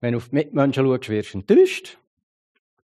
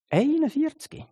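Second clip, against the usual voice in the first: shocked disbelief; 14 semitones higher than usual. Real, not acted. Shocked at suicide figure.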